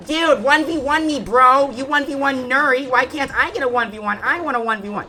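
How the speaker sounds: high-pitched mock pestering gamer; Sebastian. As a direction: beg insistently like a pushy kid. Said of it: "if Sebastian was to go something like".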